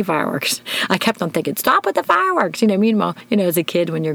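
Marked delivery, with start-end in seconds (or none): 1.6-2.6 exasperated. Retold or imitated. Annoyed at neighbors' fireworks.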